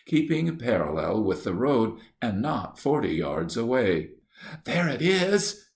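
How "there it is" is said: high, frightened gasp of alarm, voiced not whispered; a girl spots the panther in fright; terrified cry. A narrator's reading. Lou sees the panther and cries out, high and scared. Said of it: "came in a terrified whisper".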